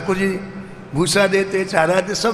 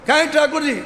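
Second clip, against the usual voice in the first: angry demand; 7 semitones higher, faster than usual. Acted. Angry guru demands the idol.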